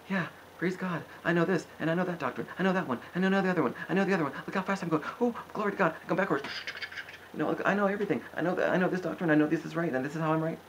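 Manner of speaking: high, fast, smug mock voice of a doctrine know-it-all. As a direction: rattle it off high and pleased with yourself, mocking. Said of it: "Just like".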